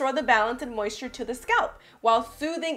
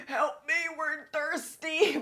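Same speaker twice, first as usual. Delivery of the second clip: higher, slow pleading little voice; thirsty scalp voice; 5 semitones higher, slower than usual. Pretend. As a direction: beg weakly like a parched scalp. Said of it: "my scalp is like".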